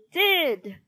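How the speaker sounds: high, loud one-word comeback; shouted retort. A narrator's reading. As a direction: shout back in a playground squabble. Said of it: "shouted Inky Ian".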